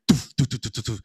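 quick run of puffed thuds; mouthed bounce noises in a rapid string; imitated bouncing ball. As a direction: mimic a golf ball skipping past.

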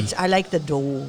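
lightly put-on Wisconsin; Wisconsin accent. Cook admiring the dough, playful Wisconsin accent. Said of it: "a real, uh, Wisconsin accent".

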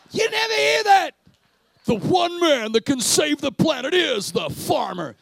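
fiery preacher at full volume; preaching shout. Real, not acted. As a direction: shout it out to the crowd. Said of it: "preacher shouting".

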